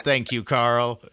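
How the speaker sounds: normal talking voice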